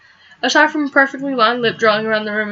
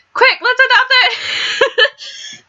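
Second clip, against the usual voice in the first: high, slow, laughing eager plea; gushing over a cute stray dog; excited; 12 semitones higher, much slower than usual. Real, not acted. Eager gamer reacting to stray dog.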